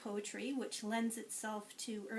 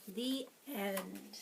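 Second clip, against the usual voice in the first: lively, sing-song picture-book read-aloud; reading to children; 4 semitones higher, 6 dB louder, slower than usual. Acted. Teacher reading a picture book to preschoolers, lively and sing-song. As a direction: read it to a class of little ones.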